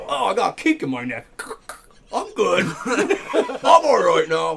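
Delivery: high, loud, laughing shrug after a crash; hammed-up stuntman shaking it off; dazed stunt guy. Doug climbs out of the wrecked car, mock casual. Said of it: "He gets out".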